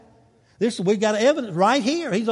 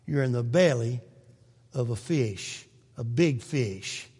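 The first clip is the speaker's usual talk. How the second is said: quiet tender reassurance; 9 semitones lower, 6 dB quieter than usual. Real, not acted. Preacher softly reassuring the congregation of God's love. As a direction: comfort them gently.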